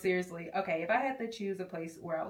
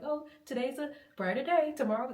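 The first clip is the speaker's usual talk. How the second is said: walking advertisement; 5 semitones higher, 4 dB louder than usual. Acted. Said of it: "sounding like a walking advertisement board".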